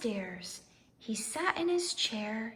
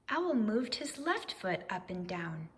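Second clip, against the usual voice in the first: ordinary delivery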